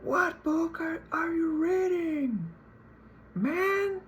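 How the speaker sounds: drawn-out, high-pitched yell; informal yelling. Shown as a demonstration. Student yells at a stranger across a library table, rudely informal yell. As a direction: yell it way up high, stretching the words. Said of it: "I yell to him".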